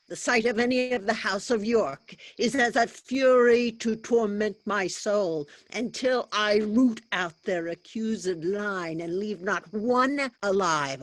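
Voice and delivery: loud, seething with vengeance; furious outburst. Acted.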